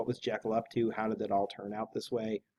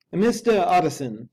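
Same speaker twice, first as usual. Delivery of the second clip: a frightened servant's high, loud plea; the worried butler calls out, pitched up; high, loud, fearful manservant; anxious butler; 6 semitones higher, 8 dB louder than usual. A narrator's reading. Poole's anxious voice in story.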